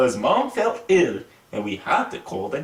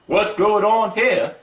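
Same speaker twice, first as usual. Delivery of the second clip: higher, louder, slow demand of a stern schoolteacher; stern teacher; 8 semitones higher, 5 dB louder, slower than usual. Acted. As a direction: call out to the boys like a strict teacher. Teacher catches boys fighting.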